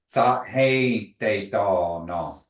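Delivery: Sa He Te Do No, slow and separated; staccato. Shown as a demonstration. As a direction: sound out Sa He Te Do No slowly. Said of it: "one more time and say".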